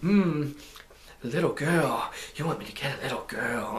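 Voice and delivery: imitating a leering bad guy; lightly voiced creep, licking his lips; hum, then leer through the line. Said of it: "he's licking his lips".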